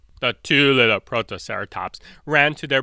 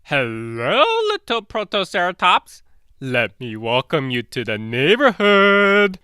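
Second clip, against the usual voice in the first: fake-sweet T-Rex; 7 semitones higher than usual. T-Rex sweetly greets his prey.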